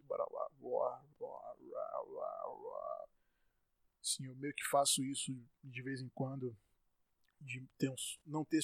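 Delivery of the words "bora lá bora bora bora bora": put-on lighter, neutral-accent voice, lower and softer; mild put-on accent-free southern voice; a touch of a neutral São Paulo accent; accentless voice. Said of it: "é um branco que não tem sotaque".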